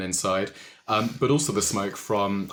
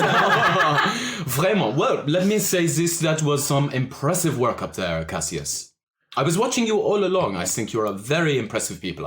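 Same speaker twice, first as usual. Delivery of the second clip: higher, louder, laughing suave charmer; amused French orc; 5 semitones higher, 4 dB louder than usual. Acted. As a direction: flatter them with a French purr and a laugh. GM plays Le Chat.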